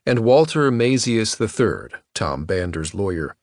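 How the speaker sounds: ordinary delivery, nothing marked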